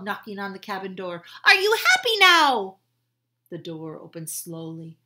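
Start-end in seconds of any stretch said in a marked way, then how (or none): 1.4-2.8 high, loud hopeful question; eager call. Acted. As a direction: bullfrog asking the captain, high and loud. Frog calls up to captain.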